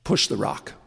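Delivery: a hushed, low urging; quiet voice of God. Acted. God sending the man back to work, hushed and low. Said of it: "the voice came to him and said".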